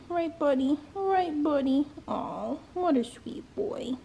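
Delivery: soft, high coo to a dog; tender pet talk. Real, not acted. Owner comforts her dog before the vet visit, tenderly. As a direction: reassure a beloved pet gently, voice lifted.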